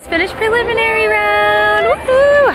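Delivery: high, loud celebratory whoop; excited cheer. Real, not acted. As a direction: cheer it out, thrilled. Cheering after audition round.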